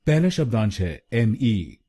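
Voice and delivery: usual speaking voice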